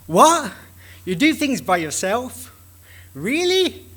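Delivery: high-pitched mock astonishment; incredulous. Engineer astonished that philosophers work by themselves, incredulous. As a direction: act taken aback, voice leaping on "Really".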